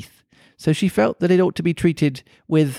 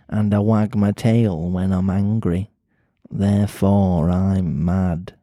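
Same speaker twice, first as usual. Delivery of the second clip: slow, lower Mancunian cat voice; Mancunian accent; 4 semitones lower, slower than usual. Acted. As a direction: drawl it slowly in a Manchester accent. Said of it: "pretty Mancunian sounding accent".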